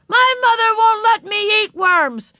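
put-on high, loud, slow kid's protest; high-pitched childish yell; little boy shouting. A narrator's reading. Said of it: "shouted a little boy".

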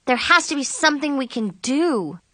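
mildly whiny, pleading; moaning. A narrator's reading. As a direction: moan it like a desperate plea. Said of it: "Zoe moaned".

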